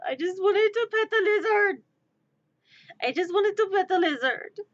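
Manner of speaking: high, loud whiny lament; hammed-up heartbroken complaint; mock wail. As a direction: wail it like a thwarted child. Mock upset over escaped lizard.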